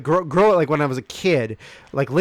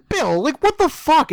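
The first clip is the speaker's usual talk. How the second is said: 1 incredulous scolding; 13 semitones higher, 4 dB louder than usual. Retold or imitated. Friends scolding Bill.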